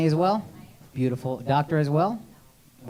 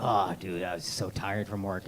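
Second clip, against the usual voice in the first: lower, much softer mock whine; whiny tired boyfriend; 13 semitones lower, 13 dB quieter than usual. Comic mocking sister's radiologist ex, a lower, soft mock whine. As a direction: mope like a spoiled complainer. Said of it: "He'd be like".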